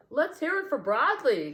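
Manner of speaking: rally the crowd; lion calling out. A narrator's reading. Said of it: "called the lion".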